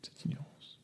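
scornful whisper. Acted. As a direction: whisper it in mock disdain.

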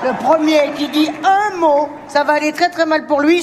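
mock threat, slowly; mock stern warning. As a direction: warn the table like a strict teacher. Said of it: "ça ne m'amuse plus".

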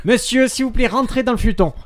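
high, loud, urgent command; shouted police order. Acted. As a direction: order a suspect to surrender, hammed up. Policier joué somme le suspect. (sommation criée de policier)

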